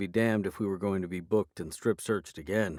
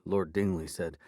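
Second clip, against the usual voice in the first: ordinary delivery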